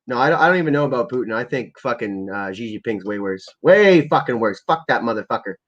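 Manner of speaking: usual speaking voice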